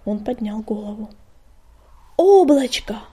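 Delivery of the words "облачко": loud bellowed name; roaring. A narrator's reading. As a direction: roar the name into the storm. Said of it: "проревел он".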